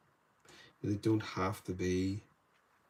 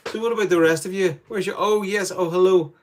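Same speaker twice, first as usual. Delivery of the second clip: bright, much higher and louder hello to viewers; light up as new viewers appear in chat; greeting the livestream chat with excitement; excited greeting; 10 semitones higher, 12 dB louder than usual. Real, not acted.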